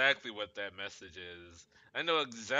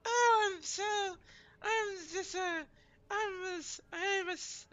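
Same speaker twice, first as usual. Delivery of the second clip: very high breathy mock girly voice; squeaky over-the-top girl impression; horrible girl voice; 3 dB louder than usual. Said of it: "with a horrible Aaron Hansen like girl voice".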